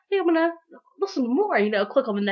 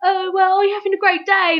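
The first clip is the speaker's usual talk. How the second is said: much higher, louder sing-song small talk; hammed-up star making small talk; mock polite celebrity; 8 semitones higher, 5 dB louder than usual. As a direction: brush the fan off sweetly. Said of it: "they're gonna be all like".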